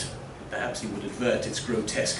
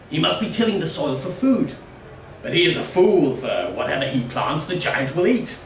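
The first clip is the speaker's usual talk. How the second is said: higher, louder scornful villager; 4 semitones higher, 3 dB louder than usual. Acted. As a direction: sneer at the digging fool. Villager voice in story.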